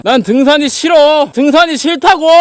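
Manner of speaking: loud, high drawn-out shout; frustrated yelling. Real, not acted. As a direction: yell your hatred of hiking at the mountain. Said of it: "내가 이래서 등산을 싫어해".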